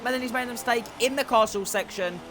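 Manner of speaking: high-pitched burst of race commentary; excited commentary. Real, not acted. Commentator calling a driver's mistake live, high and excited. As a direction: cry out the crash, then ease down.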